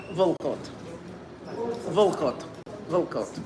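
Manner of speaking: "Vulkot" with no vowel between V and L; dialect pronunciation. Shown as a demonstration. Leader drills the dialect word "Vulkot" for the choir. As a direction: say "Vulkot" as two tight syllables. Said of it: "what you would do if you weren't gonna say a letter between the V and the L".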